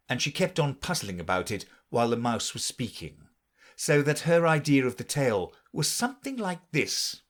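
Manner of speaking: ordinary delivery, nothing marked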